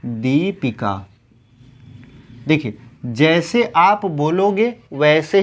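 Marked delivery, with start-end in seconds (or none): 0.0-1.0 दीपिका slowly, with a long held दी; drawn-out first ī. Shown as a demonstration. Teacher spelling it out for students, slow दीपिका. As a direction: linger on "dee" in दीपिका. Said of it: "दीपिका होता है".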